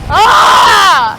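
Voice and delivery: shout. Acted. Shout after running.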